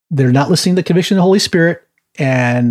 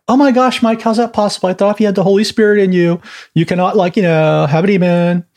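high-pitched incredulous protest, easing down; hammed-up disbelief in a high put-on voice; mock shocked objector; 6 semitones higher than usual. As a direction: imitate a scandalised believer, pitched up. Mimics a shocked objecting believer.

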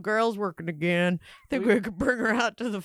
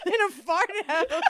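repeating it through real laughter, much higher; laughing; 11 semitones higher, slower than usual. Real, not acted. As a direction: giggle the words out high. Cracking up at the joke.

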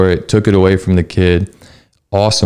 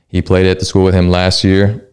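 usual speaking voice

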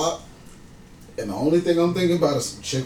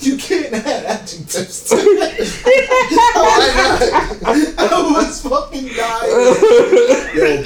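higher and louder words bursting with laughter; laughing hard; 7 semitones higher, 3 dB louder, much slower than usual. Real, not acted. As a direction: lose it completely while talking. S2 cracking up at the story.

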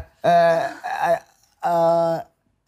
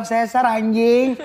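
laughing; 8 semitones higher, 5 dB louder, much slower than usual. Retold or imitated. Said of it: "gue bilang".

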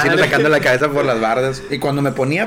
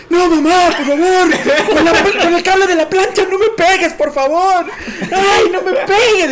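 very high, loud pleading yell; mock screaming; 6 dB louder than usual. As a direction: beg for mercy so the whole street hears. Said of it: "me ponía yo a gritar en el patio". (gritos fingidos de súplica)

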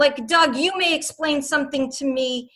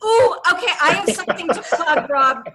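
laughing; 4 semitones higher, 4 dB louder than usual. Real, not acted. Excited laugh at being prompted.

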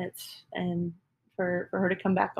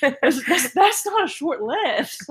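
high, laughing self-mockery; 8 semitones higher, 5 dB louder, slower than usual. Real, not acted. Laughing at her own poor decisions to the listener. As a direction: laugh through the words, much higher.

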